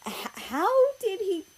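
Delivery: high, stumbling disbelief; Germany stunned to see the dog inside; stammering surprise. Acted. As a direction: trip over the words in shock.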